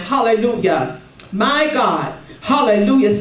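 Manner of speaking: ordinary talk, nothing marked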